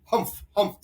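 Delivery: loud, high mocking humphs; scornful humph. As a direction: snort your disdain. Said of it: "the voice".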